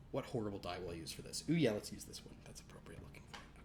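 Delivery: softly whispered aside to himself. Real, not acted. Game master choosing a cruel die, whispering. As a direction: murmur it under your breath while choosing.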